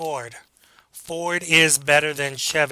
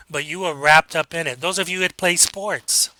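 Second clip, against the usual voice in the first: ordinary talk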